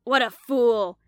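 loud, furious roar. Acted. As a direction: roar with contempt as she flees. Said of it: "roared the big Italian".

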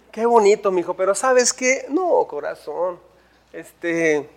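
soft, low, sighing mock tenderness; patronizing indulgence. Saul sends young David back to the sheep, soft and patronizing. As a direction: gently humour a child while brushing him off.

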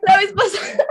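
high, loud laughing protest; high laughing yells. Real, not acted. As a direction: yell it high through laughter. Reacting loudly, laughing. (gritos agudos entre risas)

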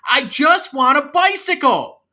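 high, loud, drawn-out tantrum yell; yelling kid. Acted. Kid yells at clown uncle for a bicycle, tantrum. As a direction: yell it like a kid refusing to budge. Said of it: "why are you yelling at me".

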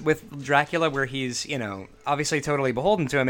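ordinary delivery

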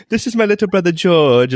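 put-on high British kid voice; mock-British catchphrase. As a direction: chirp it in a high British accent. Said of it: "saying little things in British".

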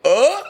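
short, high-pitched yelp of alarm; retold alarmed gasp. Telling how the toilet filled up, high mock gasp.

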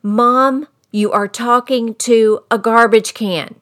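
the son shouts it into mom's ear, loud. Retold or imitated. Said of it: "very loudly in my ear he said this".

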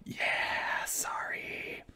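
barely voiced, soft whisper; whispered sorry. Awkwardly ending the call with a crying client. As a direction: trail off into a whisper. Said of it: "And I'm like".

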